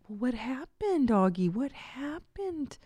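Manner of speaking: soft, half-whispered, high lilting question; concerned teacher. Retold or imitated. Teacher asking arguing four-year-olds what happened, soft concern. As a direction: imitate a gentle, attentive preschool teacher. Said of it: "she was so concerned".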